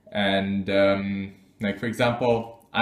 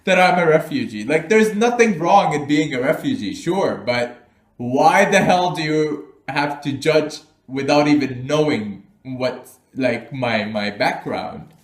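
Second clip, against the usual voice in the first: higher, louder indignant comeback; indignant retort; 4 semitones higher, 6 dB louder than usual. Retold or imitated. Retelling her snap at someone calling her a refugee, indignant. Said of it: "I was like".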